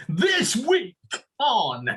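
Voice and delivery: high-pitched game show host opener. Shown as a demonstration. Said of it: "use your game show voice".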